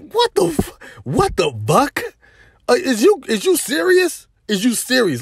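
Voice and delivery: high, incredulous cry; can't believe what she just did; disbelieving outrage. Retold or imitated. To the girl blaming him, high incredulous outrage.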